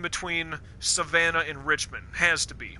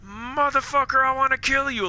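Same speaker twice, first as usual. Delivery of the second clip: higher, louder mock furious yell; mock Carver rage; 8 semitones higher, 4 dB louder than usual. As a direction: ham up Carver's murderous rage. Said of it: "At least Carver was like".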